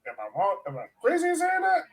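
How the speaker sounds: ordinary delivery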